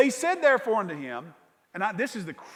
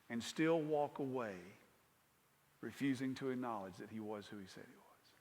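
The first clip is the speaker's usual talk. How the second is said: sorrowful; 7 semitones lower, 9 dB quieter than usual. Real, not acted. Sorrowful reflection, soft and slow.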